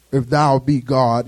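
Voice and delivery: low, slow, pompous scripture voice; mock the stiff biblical devil; old King James voice. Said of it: "they talk like this probably".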